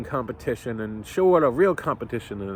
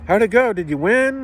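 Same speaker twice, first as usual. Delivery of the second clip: higher, louder eager mom voice; 7 semitones higher, 5 dB louder than usual. Retold or imitated. Mom greets her son at the car, higher and eager. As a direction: mom asking brightly, a bit louder and higher. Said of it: "and she's like".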